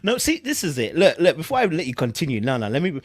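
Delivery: ordinary delivery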